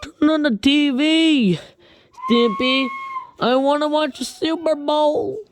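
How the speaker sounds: high, loud put-on horse voice; whiny high Mister Horse asking for the game. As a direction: demand it up high like a cartoon horse. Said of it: "Mister Horse, this".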